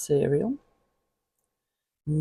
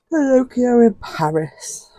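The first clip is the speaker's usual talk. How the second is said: excited; 10 semitones higher, 11 dB louder than usual. Real, not acted. Reading title excitedly, very loud high.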